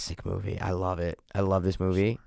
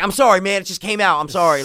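Kevin Hart apologizing; 6 semitones higher, 5 dB louder than usual. Retold or imitated. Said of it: "Kevin Hart just smacks him in the face. Like".

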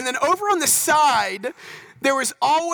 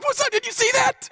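high-pitched mock triumph; hammed-up, high victorious cry; acted victorious boast; 12 semitones higher than usual. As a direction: crow it in a high voice, like a record-breaker. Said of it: "you come up victorious".

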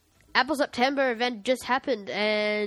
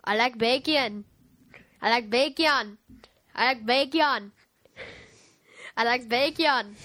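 mock Jamaican accent, repeated like a warm-up; slower than usual. Shown as a demonstration. Getting into character for a Jamaican cow, Jamaican accent. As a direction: roll into a put-on Jamaican lilt. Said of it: "way I get into a Jamaican accent".

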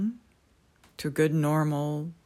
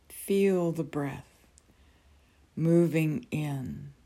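guided meditation. Acted. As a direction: ease them gently into calm.

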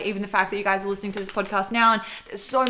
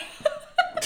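airy laugh. Real, not acted. Laughing at partner's joke.

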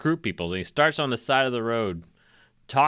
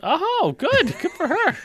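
very high, chirpy "good for her"; chirping sarcastic praise for the hanged woman; mock cheer; 4 dB louder, slower than usual.

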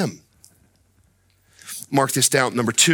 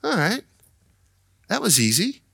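mock calming-down sigh, pitched up; acted relief; 8 semitones higher than usual. Preacher acts someone who counted to ten, relieved. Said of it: "when you got to ten, you're like".

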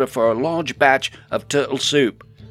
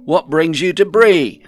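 loud, high-pitched welcome; big raised-voice hail; hearty greeting; 8 semitones higher, 12 dB louder than usual. A narrator's reading. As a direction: boom out a question to a visiting friend. Sig hails a visiting friend.

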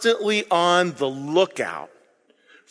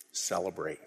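hushed celebrate; 13 semitones lower, 15 dB quieter than usual. Retold or imitated. Dead husband's word in dream.

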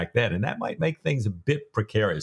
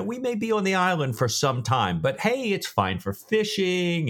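putting on good face; 7 semitones higher than usual. Acted. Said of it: "he puts on a good face".

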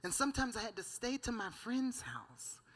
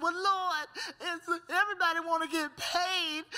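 higher-pitched mock protest to God; acted-out griping in a raised pitch; retold complaint; 7 semitones higher, 4 dB louder than usual. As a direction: plead your grievance, imitating a complainer. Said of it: "I finally was complaining to the Lord".

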